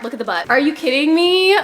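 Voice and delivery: usual speaking voice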